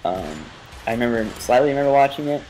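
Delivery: normal talking voice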